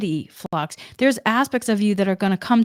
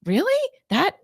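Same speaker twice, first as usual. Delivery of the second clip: lilting, high-pitched wonder at a discovery; curious, fascinated; 4 semitones higher than usual. Retold or imitated. Seventeen-year-old girl marvelling at learning R's, curious and fascinated. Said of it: "was curious about that".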